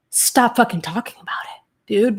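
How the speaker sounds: whispered, fed-up plea to stop; exasperated whisper. Real, not acted. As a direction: hiss it out under your breath, annoyed. Venting about Gabby ignoring pleas.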